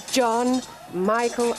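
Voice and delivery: plain reading voice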